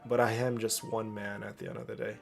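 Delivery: ordinary talk, nothing marked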